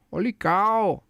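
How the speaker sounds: high-pitched, drawn-out "cow"; big comic holy-cow exclamation; exaggerated amazement. Real, not acted. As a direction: play up the astonishment, voice leaping up.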